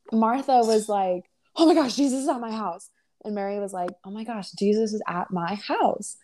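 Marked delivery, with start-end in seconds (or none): 1.5-2.9 freaking out, higher and rushed; Martha. Retold or imitated. Martha reacts to Jesus at her house, freaking out. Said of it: "Martha started freaking out". 4.0-6.2 chill, unhurried wonder; Mary. Retold or imitated. Mary stops for Jesus at her house, calm and chill. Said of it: "She's just so chill".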